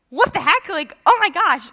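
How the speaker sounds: high, loud burst of amazement; astonished. Acted. Reader finds a verse in context, astonished. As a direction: blurt it out, acting stunned by a discovery.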